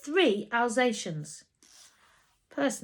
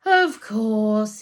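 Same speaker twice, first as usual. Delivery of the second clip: heavy sarcasm; 8 dB louder than usual. Acted. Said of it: "he said heavily".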